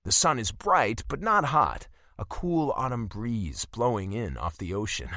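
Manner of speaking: ordinary delivery, nothing marked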